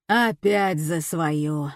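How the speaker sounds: slow, huffy grumble; пыхтение. A narrator's reading. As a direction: grumble wearily, huffing at your wife. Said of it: "запыхтел Лёша". (недовольно пыхтя, медленно)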